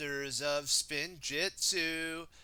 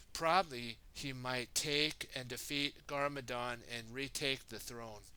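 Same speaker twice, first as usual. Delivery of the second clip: ordinary delivery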